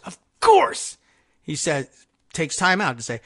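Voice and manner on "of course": mock "of course". Host mocks Trump's self-praise.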